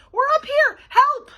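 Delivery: high, loud shout; calling for help. A narrator's reading. Bean up in the attic calling to babysitter, shouting. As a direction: yell to be heard from afar.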